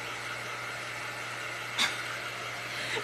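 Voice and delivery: airy unvoiced chuckle; soft breathy laugh. Real, not acted. Pleased with her prank, soft airy laugh. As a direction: laugh softly under the breath.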